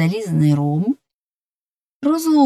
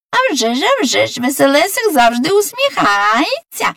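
high, sing-song little fox voice; chirpy fox; 8 semitones higher than usual. Acted. Fox sister agrees about Veselesyk, high and chirpy. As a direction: chime in high and eager as the fox.

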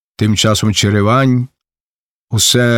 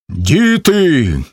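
raised call to his men; old Shram shouts; 4 semitones higher than usual. A narrator's reading. Old Shram calls to his own men, shouting. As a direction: call out to rally them. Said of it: "крикне на своїх старий Шрам".

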